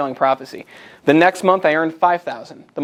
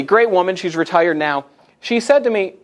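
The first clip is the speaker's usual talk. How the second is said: ordinary talk, nothing marked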